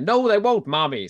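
high, hammed-up Irish daughter; Irish accent. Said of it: "See, I'm doing Irish now".

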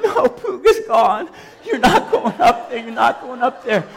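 cling to the pew, half laughing; laughing panic. Retold or imitated. Left alone in the back pew, laughing mock panic.

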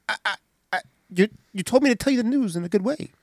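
fast stammering excuse; stammer, then rush it out; flustered Roddy. Retold or imitated. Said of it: "Roddy's like".

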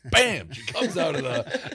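bam, like she's bursting out of the smoke; excited build-up. Retold or imitated. Telling the host about the Orange Bowl entrance, excited and laughing. Picturing a girl emerging from smoke.

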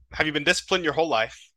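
normal talking voice

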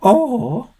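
very high, loud squeaky voice; shrill milk lady. A narrator's reading. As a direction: squeak it out like a batty shopkeeper. Frau Milk pausing.

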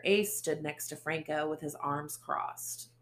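plain reading voice

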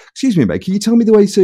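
a lightly voiced passer-by asking directions; stranger asking directions. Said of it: "He goes".

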